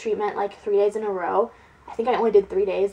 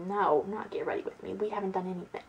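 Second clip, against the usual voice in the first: normal talking voice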